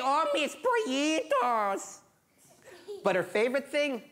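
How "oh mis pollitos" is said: very high, stretched-out coo; doting old woman. Acted. Old woman calling her chickens, high slow coo. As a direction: draw it out high, cooing. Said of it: "And she'd say".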